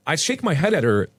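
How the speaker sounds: plain reading voice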